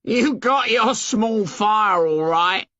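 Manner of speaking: high, slow mocking taunt of a schoolboy; sarcastic jeer. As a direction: drag it out like a sneering bully. Jack taunts Ralph about fire.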